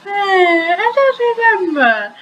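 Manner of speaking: high, evasive character voice; covering up. A narrator's reading. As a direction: brush it off in a high-pitched voice. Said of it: "I covered".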